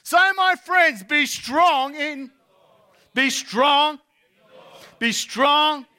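loud, high-pitched rallying sermon; full-force preaching. Real, not acted. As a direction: preach it out to the whole hall.

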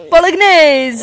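loud, high mock-Italian exclamation. Showing off Italian words to co-host, mock Italian. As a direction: proclaim it in hammed-up Italian. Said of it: "I know lots and lots of Italian words".

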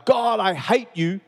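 yelling out to God in the backyard, anguished; retold yell at God. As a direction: shout it up at God in despair. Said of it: "I remember yelling out".

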